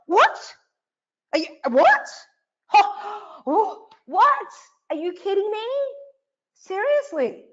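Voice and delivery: over-the-top gasping amazement; mock astonishment. Coach acting amazed at the audience's inner voice, hammed-up disbelief. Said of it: "I'm looking a little bit over the top".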